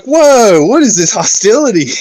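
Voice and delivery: mock shock. Reacting to crew kicking Wesley off bridge, high startled whoa. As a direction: gasp it out high in disbelief.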